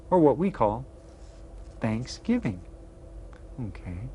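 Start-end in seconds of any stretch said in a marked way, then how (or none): none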